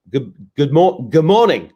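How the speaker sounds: a light stab at an Australian good morning; greeting the viewer in a playful Australian accent; mock Australian accent. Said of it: "is that how you say that in Australian?".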